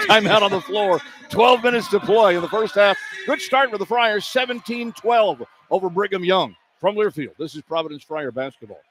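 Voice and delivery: announcer read into the break; formal station-break announcement; broadcast sign-off. Real, not acted. Break announcement before commercials.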